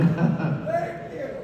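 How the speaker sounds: high-pitched burst of laughter. Real, not acted. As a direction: laugh hard. Laughing at own story.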